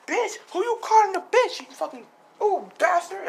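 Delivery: high, loud acted tirade; hammed-up angry comeback; outraged insult. Mitsuki's acted quarrel with Bak.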